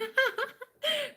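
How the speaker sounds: high-pitched burst of laughter; real high laugh; high giggle. Real, not acted. Laughs at a viewer's comment.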